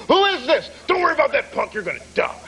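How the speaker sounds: a hammed-up prank caller menacing; mock tough threat. Prank caller menacing his English teacher, hammed-up tough voice.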